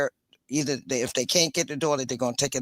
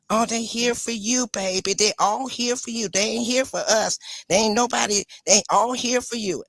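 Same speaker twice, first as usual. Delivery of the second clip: imitating the mother, pitched higher and sing-song; mocking jealous mother; 7 semitones higher than usual. Grandmother imitating the jealous mother's taunts, higher and mocking. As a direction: mimic a resentful mother cooing at the baby. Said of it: "that's all I kept hearing and kept hearing".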